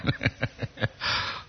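soft wheezing laugh; helpless soft chuckle; laughter. Real, not acted. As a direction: let a quiet laugh trail out breathily. Laughs at the comment just read.

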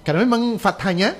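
ordinary talk

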